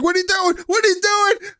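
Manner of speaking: high, shouted panicked dad; imitating father yelling. Retelling how dad screamed during the prank, panicked shout. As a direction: yell it like a startled father. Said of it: "He was like".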